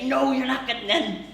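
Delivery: high, loud refusal; mock shout. Mother shouting refusal at dad, high mock shout. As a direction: shut him down hard.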